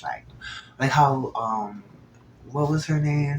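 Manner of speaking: ordinary delivery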